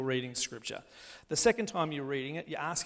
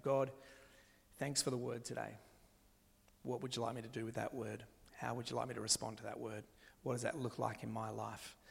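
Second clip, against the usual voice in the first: soft, low contemplative prayer; quiet prayer; 3 semitones lower, 8 dB quieter than usual. Acted. Preacher modelling a reflective prayer to God, quiet and low. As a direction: ask God softly, unhurried.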